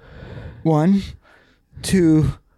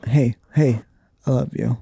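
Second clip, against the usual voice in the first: mock tender; 6 semitones lower, 6 dB quieter than usual.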